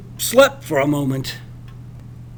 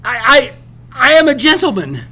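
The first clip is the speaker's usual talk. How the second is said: higher, louder, rushed stammering; flustered stammer; 6 semitones higher, 6 dB louder than usual. Acted. Rattled narrator stammering his answer to the captain. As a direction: trip over the I's in a flustered rush. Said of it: "had sillily stammered".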